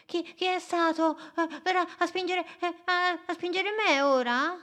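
high, fast, stammering worry; scared otter asking who pushed, high and quick; frightened otter. Acted. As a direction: stumble over the words in a panic.

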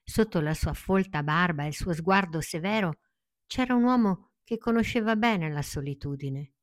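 usual speaking voice

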